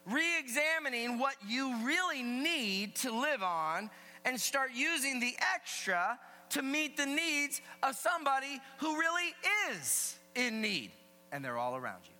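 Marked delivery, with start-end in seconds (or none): 0.1-10.9 high, loud sermon voice; emphatic preaching. Real, not acted. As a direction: preach it to the whole room with punch. Preaches at full voice.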